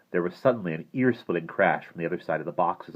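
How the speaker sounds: ordinary delivery, nothing marked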